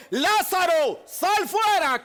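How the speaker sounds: high, loud, drawn-out call to the dead; preacher booming Jesus' command. Retold or imitated. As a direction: call Lazarus out of the tomb, slowly and loud. Jesus calls Lazarus out.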